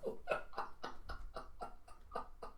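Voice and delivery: soft, voiceless laughter; real laugh petering out; trailing laugh. Real, not acted. Storyteller still amused by the scene, soft laughing.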